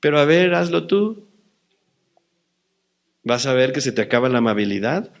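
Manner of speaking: ordinary talk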